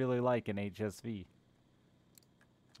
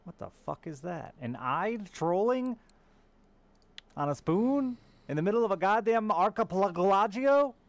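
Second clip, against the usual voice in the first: disbelief; 10 semitones higher, 8 dB louder than usual. Real, not acted. Shocked at odd catch on stream.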